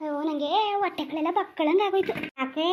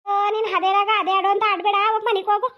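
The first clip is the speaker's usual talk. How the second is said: higher, louder telling-off; sharp scolding; 6 semitones higher, 5 dB louder than usual. Acted. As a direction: shoo her home impatiently. Scolding grandmother to leave.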